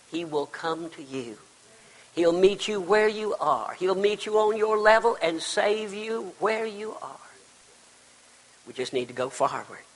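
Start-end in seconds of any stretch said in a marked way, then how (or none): none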